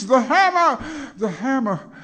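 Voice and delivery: from high and loud to very high and very loud; building shout. Acted. Hammer refrain building.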